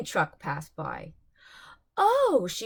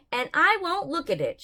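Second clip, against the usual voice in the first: stubborn; 6 semitones higher, 4 dB louder than usual. Acted. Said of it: "she added".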